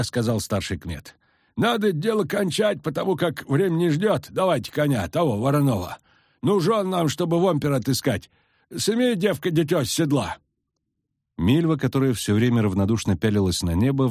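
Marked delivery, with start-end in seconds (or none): 1.6-10.4 high, folksy village peasant; rustic old villager, eager. A narrator's reading. Peasant elder voice held.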